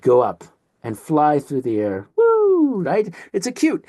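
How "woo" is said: high, loud swooping woo; playful "woo". Shown as a demonstration. Teacher mimics a car flying through the air, playful woo.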